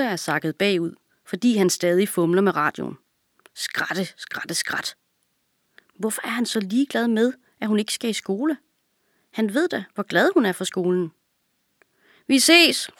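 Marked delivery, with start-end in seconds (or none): 3.5-5.0 whispered crackling static noises; radio crackle whisper. A narrator's reading. Narrator mimics the broken radio in a whisper. 12.3-12.9 high, loud farewell call. A narrator's reading. Girl calling back to her brother as she leaves. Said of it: "råber hun til ham".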